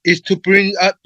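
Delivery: ordinary talk, nothing marked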